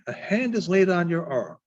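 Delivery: plain reading voice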